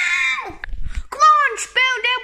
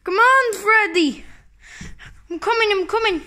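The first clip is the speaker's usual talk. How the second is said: ordinary delivery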